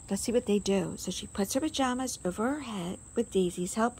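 usual speaking voice

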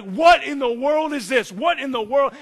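loud, high mock panic; freaking out. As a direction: act out a startled freak-out at full volume. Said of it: "Mary is freaking out".

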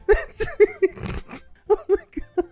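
high squealing laughter through the words; laughing hard. Real, not acted. Laughing at a buff cartoon picture. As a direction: break up laughing mid-sentence. Laughing at shirtless Captain America.

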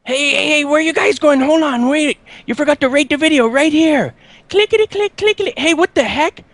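squeaky high cartoon voice. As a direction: pitch up like a cartoon. Outro character voice.

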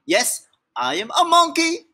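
high goofy monkey; silly monkey voice. Acted. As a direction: bounce it like a cheeky monkey. Book contradicts reader.

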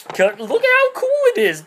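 high squeaky cartoon voice; mock character voice. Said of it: "he's like".

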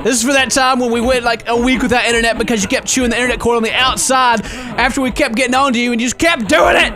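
ordinary delivery

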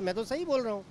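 ordinary talk, nothing marked

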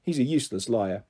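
usual speaking voice

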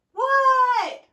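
high, very loud shout; high-pitched, drawn-out yell; yelling back. A narrator's reading. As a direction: holler it back at the top of the voice. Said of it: "yelled Froggy".

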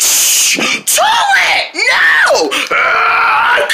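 high, loud enraged scream; high tantrum shrieks; furious yelling. Acted. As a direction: lose your temper and yell it out. Angry toy yelling.

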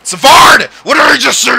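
high, loud outraged call; shocked shout. Real, not acted. Gamer scolding his teammate, shocked shout. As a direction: yell at the player who blew it. Player blunders during game.

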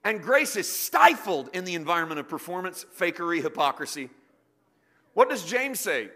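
loud, high, impassioned preaching; full-force preaching. Acted. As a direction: preach it out with fire.

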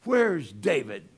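Saul's loud, slow angry demand; slow, loud, angry king. Retold or imitated. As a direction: bark it out slowly like a furious king. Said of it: "Saul was angry".

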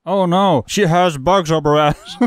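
usual speaking voice

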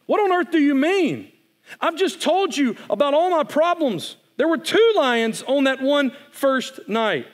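high and loud, protesting in disbelief; a boy who can't believe he's contradicted; indignant boy. Acted. Boy insisting to the voice there were two lions, indignant.

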